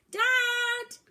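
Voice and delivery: long, high, very loud sung-out call. Acted. Boy yelling for dad, very loud high call. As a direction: belt it out across the water. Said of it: "he shouted, louder still".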